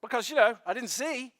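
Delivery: excited testimony. Retold or imitated. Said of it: "he's like".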